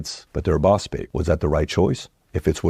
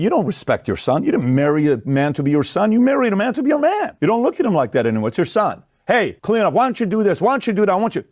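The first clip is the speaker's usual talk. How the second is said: much higher scolding wife's voice; nagging wife; 10 semitones higher than usual. Retold or imitated. Host imitates a wife ordering her husband around, high and scolding. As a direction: scold him like a naggy mother would a boy. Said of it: "you look at your husband and say".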